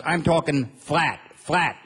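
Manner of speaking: flat, flat drawn out slowly, leaning on each flat; stress on flat. Shown as a demonstration. Comic insisting to the audience that Montana is flat, slow emphatic flat. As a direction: stretch flat out to show how flat it is. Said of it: "When I say flat".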